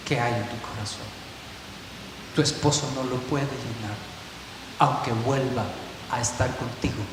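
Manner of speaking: soft, hushed and low, fading to a whisper; God comforting the woman under the table, softly; tender divine voice. Acted. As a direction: speak as God, gently and quietly. God speaking to weeping woman.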